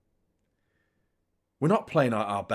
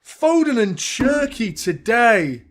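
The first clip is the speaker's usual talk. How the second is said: frustrated groan; 9 semitones higher, 7 dB louder, much slower than usual. Real, not acted. Frustrated after missed chance.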